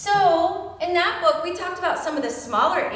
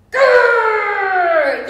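loud, higher hammed-up roar; a big mock bear growl for the kids; playful bear growl; 7 semitones higher, 9 dB louder than usual. Teacher growling like a bear for the children, hammed-up. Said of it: "I think I'm a bear".

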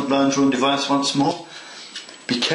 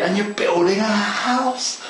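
higher, slower, gushing enthusiasm; thrilled; 8 semitones higher than usual. Real, not acted. Modeller telling viewers gushingly they are building a house. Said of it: "it's so exciting".

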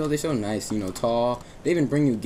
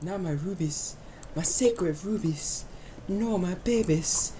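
higher and slow, hammed-up wailing over the rubies; mock grief; 8 semitones higher, slower than usual. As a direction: ham up the loss like a soap opera. Mourning lost rubies in game.

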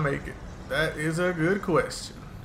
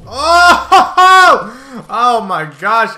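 shocked scream laugh; 3 semitones higher, 14 dB louder, much slower than usual. Real, not acted. Walks into own trap.

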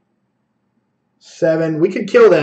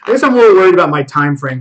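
ordinary delivery

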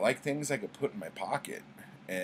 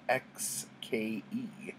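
letter by letter, slow and soft; drawn-out model name; slow spelled letters; 4 dB quieter, much slower than usual. Shown as a demonstration. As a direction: spell it out carefully.

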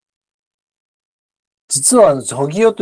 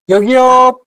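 calling out; 7 semitones higher, 5 dB louder than usual. Shown as a demonstration. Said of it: "teacher models calling to staff".

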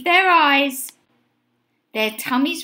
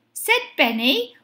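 plain reading voice

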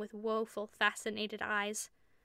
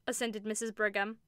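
usual speaking voice

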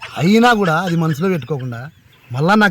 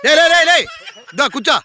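high urgent calls; 10 semitones higher, faster than usual. Acted. Uncle stops nephew leaving.

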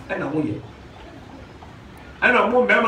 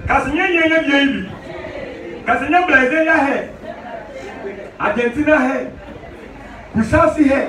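raised, high-pitched pulpit voice; impassioned preaching; 7 semitones higher than usual. Real, not acted. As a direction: preach it out to the whole congregation. Preaches at full force.

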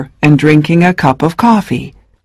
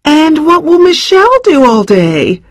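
confused; 13 semitones higher than usual. Acted. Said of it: "asked their mother, confused".

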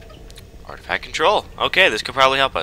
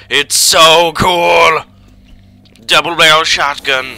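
slow, high, loud gush; drawn-out thrilled yell; excited shout; 5 semitones higher, 9 dB louder, slower than usual. Real, not acted. Showing off new gun.

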